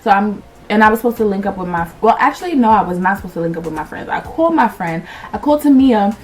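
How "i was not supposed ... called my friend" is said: normal talking voice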